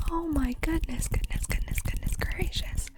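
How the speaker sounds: usual speaking voice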